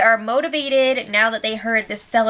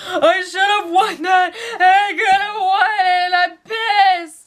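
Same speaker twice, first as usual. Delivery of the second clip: upset whiny mimic; 7 semitones higher than usual. Upset houseguest ranting about the lost competition, mock whine. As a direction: whine it like a sore loser. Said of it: "She was really upset about it".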